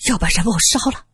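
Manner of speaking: out of breath, stammering; winded and shaken, halting; panting. A narrator's reading. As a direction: gasp the words between heavy breaths. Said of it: "慕云平喘着粗气".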